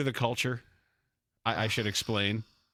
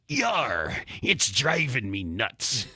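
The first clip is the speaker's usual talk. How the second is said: slow pirate growl; much slower than usual. Acted. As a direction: play the punchline like a pirate. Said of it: "And the pirate says".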